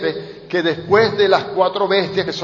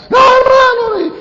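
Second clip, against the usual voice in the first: high, loud panicked yelling; frantic shouting; 8 dB louder, slower than usual. Retold or imitated. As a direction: scream it across the farm in alarm. (el pastor gritando con pánico, agudo y fuerte)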